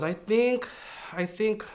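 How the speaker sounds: high-pitched, hesitant nervous voice; imitating someone anxiously hedging; nervous sister. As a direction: voice the sister's nerves, pitched well up and unsure. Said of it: "she was very nervous".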